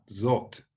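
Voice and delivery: "zot" with the o of hot; pronunciation demo. Shown as a demonstration. Teacher modelling Hebrew word for this, "zot" with short o. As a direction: say "zot" clearly, rhyming with hot. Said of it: "that's how you pronounce that".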